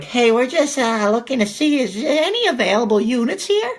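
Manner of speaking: put-on high, polite voice for the old couple; a high, timid enquiry; old couple asking. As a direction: lightly play the sweet old visitors.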